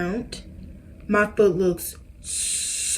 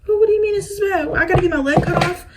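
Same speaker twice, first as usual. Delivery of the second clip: high, fast, frightened questions; panicked questioning; 10 semitones higher, 3 dB louder, faster than usual. Retold or imitated. Patient asking the doctor, high fast panic. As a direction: blurt it out in a rising panic. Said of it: "I was scared".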